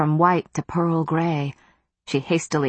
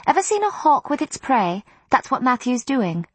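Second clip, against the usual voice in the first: high, bright-ringing vampire lady; bell-like soprano; 8 semitones higher than usual. A narrator's reading. Miriam explaining to the witches, high soprano chime. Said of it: "her bell-like soprano chimed".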